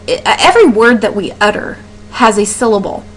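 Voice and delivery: usual speaking voice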